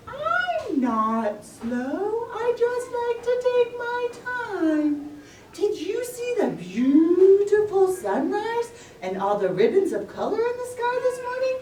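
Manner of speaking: very high, slow sing-song tortoise voice; a gentle, unhurried tortoise. Acted. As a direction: drawl it out like a dreamy old tortoise.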